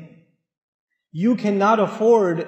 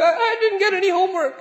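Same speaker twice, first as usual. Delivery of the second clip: put-on higher, timid child stammering; scared kid voice; 9 semitones higher than usual. Child answering the angry father, higher and timid. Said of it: "The kid's like".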